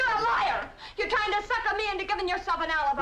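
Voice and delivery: angry yelling. Acted. Woman yelling at her lover over an alibi, furious. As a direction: yell the accusation at him. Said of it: "Will you please stop yelling".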